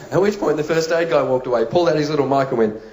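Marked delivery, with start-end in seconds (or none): none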